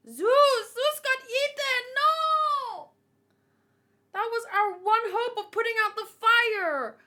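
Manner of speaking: high, loud shocked cry. Real, not acted. Fan shocked that Zeus got eaten, high and loud. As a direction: cry out as your hope gets eaten.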